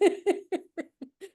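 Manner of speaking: bright high laugh. Real, not acted. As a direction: laugh at the joke. Laughs at own bit.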